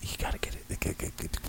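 hushed egging-on whisper; urgent whisper. Pretend. Crude joke, egging on.

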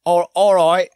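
put-on high, loud, botched Australian accent; English-sounding stab at an Aussie accent; accent attempt drifting English. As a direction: try out an Australian accent, loudly. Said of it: "that's not I'm doing English".

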